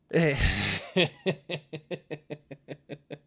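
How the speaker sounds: long, high wheezing giggle fit; laughing. Real, not acted. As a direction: lose it before the punchline. Cannot get to the punchline.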